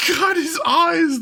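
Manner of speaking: very high, drawn-out wail; aghast exclamation. Real, not acted. Streamer upset by the drawn eyes, aghast. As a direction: cry out slowly in dismay. Reacting again to game art.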